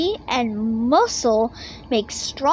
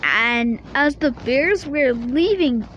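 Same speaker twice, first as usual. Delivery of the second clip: ordinary delivery, nothing marked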